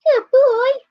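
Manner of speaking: high, bright-eyed praise for the dog; pet praise voice. Real, not acted.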